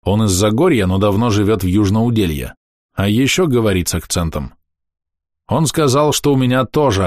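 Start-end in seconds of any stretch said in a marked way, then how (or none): none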